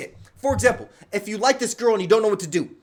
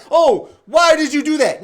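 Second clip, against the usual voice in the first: higher, louder mock complaint; acted mad; 7 semitones higher, 8 dB louder than usual. Streamer mimicking a guy mad at a girl, mock-angry. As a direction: protest like someone mad at a girl. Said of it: "you can't get mad at them".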